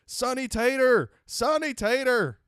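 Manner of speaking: loud, high, slow Alabama yell. Retold or imitated. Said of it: "redneck dudes ... screaming".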